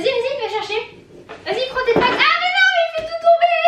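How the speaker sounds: high-pitched excited urging. Real, not acted. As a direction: squeal at the dog as it fails. Dog knocks everything over.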